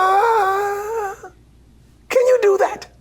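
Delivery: high, sung-out demo note then question; British director impression. Director demonstrating the exact pitch, high voiced. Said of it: "He said".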